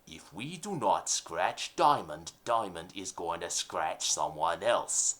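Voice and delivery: low, slow gangster boss voice; mafia boss. Acted. Mob boss ordering his henchman, low and slow. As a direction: growl it like a nineteen thirties mob boss.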